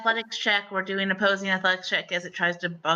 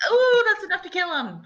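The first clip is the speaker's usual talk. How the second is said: excited reaction; 12 semitones higher, 4 dB louder than usual. Real, not acted. Game master hails the killing blow, excited. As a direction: exclaim it high and excited at the hit.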